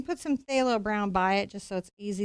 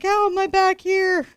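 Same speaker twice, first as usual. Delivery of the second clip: surprised disbelief; 9 semitones higher, 8 dB louder than usual. Retold or imitated. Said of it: "I'm like".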